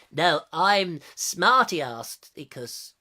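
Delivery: slow, drawn-out wordplay; teasing slow Smarty-Articus; slow pun delivery. Pretend. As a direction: savour the pun slowly.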